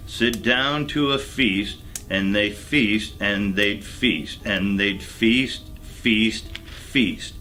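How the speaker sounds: ordinary delivery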